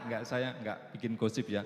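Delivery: ordinary talk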